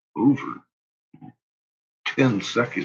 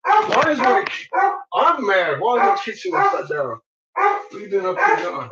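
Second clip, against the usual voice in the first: pet talk scolding. Real, not acted.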